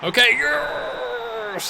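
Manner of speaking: high, drawn-out shout of joy; triumphant yes. Real, not acted. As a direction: cheer the winning moment. Winning the match.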